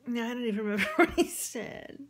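real laugh breaking through the words. Real, not acted. Teller admitting she forgot what he said, laughing. Laughs at own failed memory.

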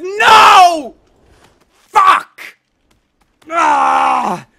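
sudden bellow of rage; raging scream. Real, not acted. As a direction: let it explode out of you. Failing the jump again.

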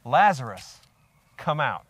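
loud, commanding call; projected command from a scripture reading. A narrator's reading. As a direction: call him out with authority, pausing between phrases. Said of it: "Jesus said in a loud voice".

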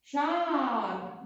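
Arabic teacher modelling a word slowly; slow model word. Shown as a demonstration. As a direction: stretch out شعر very slowly. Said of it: "يلا كلنا".

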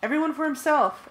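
loud high panicked yell. A narrator's reading. Narrator yells to scattering friends, loud and high. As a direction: shout it to the whole team. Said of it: "I yelled".

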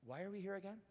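small, quiet puzzled question; bewildered bystander. Acted. One guy in the noisy crowd, quiet bewilderment. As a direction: ask softly, lost amid the uproar. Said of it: "all of a sudden one guy's like".

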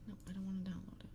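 fast unvoiced whisper. Real, not acted. Streamer muttering at a popup, fast whisper. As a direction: hiss it under your breath quickly.

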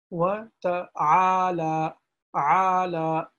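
"ta'ala" with the ayn vowel stretched long. Shown as a demonstration. Teacher showing the stretched vowel to students, slow. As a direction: draw out the "ta'ala" vowel slowly. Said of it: "to stretch this a little bit".

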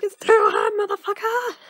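hammed-up, high-pitched scream of complaint; mock outraged shout. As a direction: yell it high and very loud. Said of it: "She's like".

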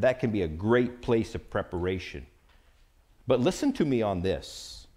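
usual speaking voice

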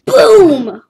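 loud burst of a sound effect; excited boom. Real, not acted. Gamer narrating his ragdoll crashing, loud boom. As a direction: shout it like an explosion.

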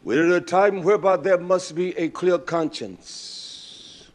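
slow, loud, high sermon delivery; full-force preaching. Acted. Preacher exhorting the congregation, slow loud preaching. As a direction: preach it out slowly to the room.

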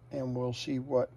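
ordinary delivery

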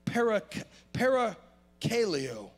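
parakaleo said slowly, each syllable drawn out; Greek word. Shown as a demonstration. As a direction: slowly spell out parakaleo in sound. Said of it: "something about that word".

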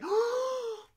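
a very high, loud gasp of surprise; big gasp. Real, not acted. Streamer pulling a hyper rare Melmetal, gasping loudly. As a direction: gasp like you hit the jackpot.

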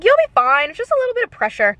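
high, quick breezy reassurance; reassuring woman. Retold or imitated. Woman from the lobby reassuring her, high and breezy. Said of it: "She's like".

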